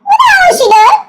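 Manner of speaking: high, laughing mock outcry; imagined gawking neighbors. Neighbors watching the squirrel chase, high mock disbelief. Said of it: "if the neighbors were looking at".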